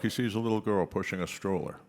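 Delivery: ordinary delivery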